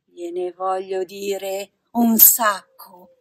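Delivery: loud, indignant threat; theatrical angry warning; threatening. A narrator's reading. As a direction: vow revenge at full voice, drawing out the end. Pinocchio threatens Lucignolo.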